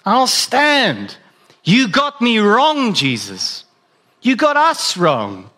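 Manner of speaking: a bold disciple insisting on his loyalty; earnest Peter. Acted. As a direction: Peter protesting he is solid rock. Peter's voice in the retold scene.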